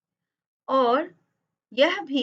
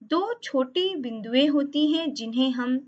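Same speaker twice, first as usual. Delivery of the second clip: usual speaking voice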